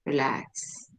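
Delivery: gently settling yourself on the exhale; soothing self-talk. Shown as a demonstration. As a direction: breathe out and calm yourself down. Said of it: "I tell myself".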